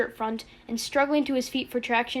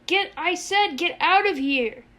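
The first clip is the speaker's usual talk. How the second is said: raised, high-pitched frantic order; exasperated yell slowing at the end; desperate shout; 6 semitones higher, 5 dB louder, slower than usual. A narrator's reading. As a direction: shout it, close to tears. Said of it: "Yossarian shouted and broke into tears".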